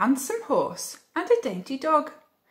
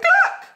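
very high, loud squawked cluck; short piercing chicken noise; hen cluck; 10 dB louder than usual. A narrator's reading. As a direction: cluck sharply like an alarmed hen. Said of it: "the hen said".

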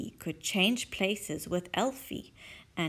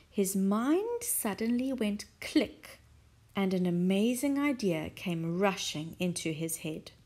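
usual speaking voice

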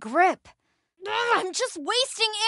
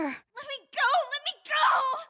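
panicked; 7 semitones higher, 3 dB quieter than usual. Acted. Struggling free from a grab.

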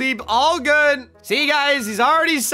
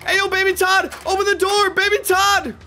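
high, urgent plea through a door; panicked calling; 8 semitones higher than usual. Acted. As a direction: demand to be let out, spooked. Trapped dad calls out.

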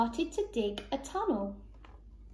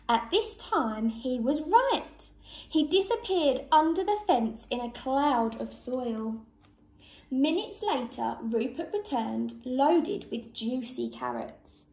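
plain reading voice

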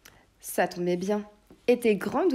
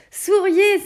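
excited call; 12 semitones higher, 11 dB louder than usual. Acted. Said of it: "demanda-t-il au chevalier et au lutin".

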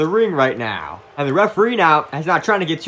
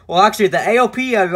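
ordinary talk

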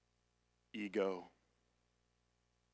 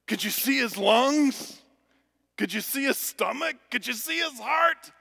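put-on little boy; 10 dB louder than usual. Young son pressing dad, climbing to an excited shout. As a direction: build the kid's wonder until he yells heart. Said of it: "his son said".

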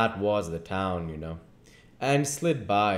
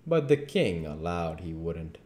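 usual speaking voice

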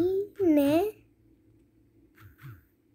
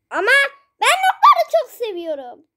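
excited; 7 semitones higher, 6 dB louder than usual. Real, not acted. Excited gamer cry.

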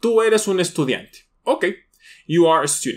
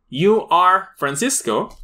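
"You are" said slowly as two full words; full form slowly; 3 semitones higher, much slower than usual. Shown as a demonstration. Teacher contrasting full and contracted forms for students. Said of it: "exactamente dices".

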